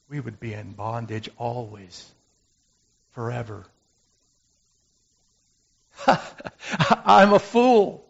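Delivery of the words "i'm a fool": laughing. Real, not acted. Laughing at himself.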